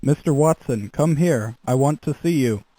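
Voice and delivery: usual speaking voice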